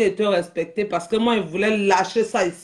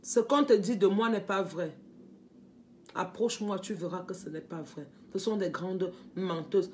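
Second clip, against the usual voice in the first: soft plea; 4 semitones lower, 9 dB quieter than usual. Real, not acted. Softly pleads with estranged sister.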